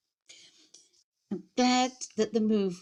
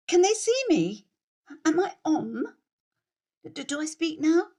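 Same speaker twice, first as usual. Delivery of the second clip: uncertain beginner on camera; 8 semitones higher, 4 dB louder than usual. Acted. Unsure whether recording has started.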